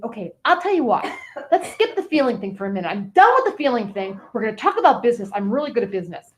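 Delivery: usual speaking voice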